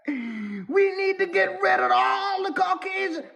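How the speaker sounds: hammed-up zealot yelling for war; mock war cry. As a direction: shout like a comic zealot ready for war. Mocking the war-ready spiritual men.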